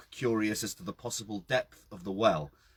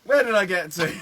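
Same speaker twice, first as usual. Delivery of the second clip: laughing greeting; 7 semitones higher, 8 dB louder than usual. Real, not acted.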